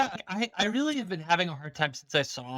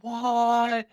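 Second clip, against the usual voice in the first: surprised; 8 semitones higher, 3 dB louder than usual. Retold or imitated. Acting crowd reaction.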